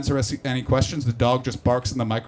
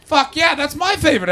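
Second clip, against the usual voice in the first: fired-up high cheer; loud, thrilled whoop; excited yell; 10 semitones higher, 8 dB louder than usual. Real, not acted. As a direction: burst out in delight at the answer. Crowd names his hero.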